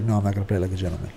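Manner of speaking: low, quick mock Trump voice; Trump impression. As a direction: mutter it fast and low like Trump. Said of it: "And Trump is like".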